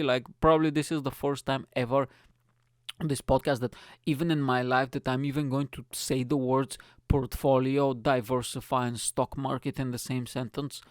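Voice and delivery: ordinary talk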